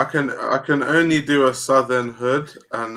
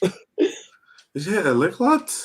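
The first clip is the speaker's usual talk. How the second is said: put-on British lad, voice rising; exaggerated British accent, lifting on the last word; mock British lad accent; 7 semitones higher, 4 dB quieter than usual. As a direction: play one of the lads, mock British. Mock British lads in accent round.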